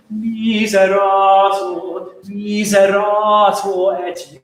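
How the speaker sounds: "miserator" slow and joined, each vowel drawn out; smooth, long-vowelled miserator, no hard accents; not too choppy. Shown as a demonstration. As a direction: stretch miserator into one connected line. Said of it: "not too choppy".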